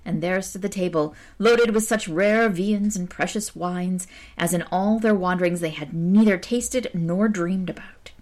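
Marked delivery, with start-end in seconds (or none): none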